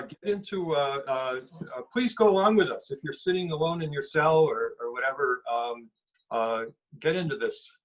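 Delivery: ordinary talk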